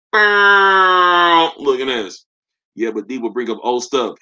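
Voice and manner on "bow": shouted gun-blast noise, far off; mock gunshot. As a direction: bark out a pretend gunshot. Mock gunshot after boom boom.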